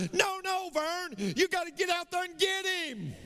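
lightly acted nudge to go get the deer; mock urging. Preacher voicing a hunter telling Vern, mock urging.

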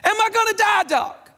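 hammed-up scared patient; mock panic. Patient panics in retold joke.

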